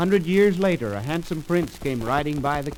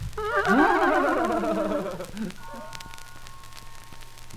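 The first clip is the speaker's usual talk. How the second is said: high squeaky giggling; pigs laughing; 10 semitones higher than usual. Acted. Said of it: "how the three little pigs laughed".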